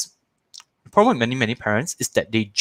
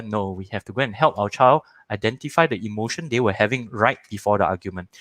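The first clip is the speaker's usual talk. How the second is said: ordinary talk, nothing marked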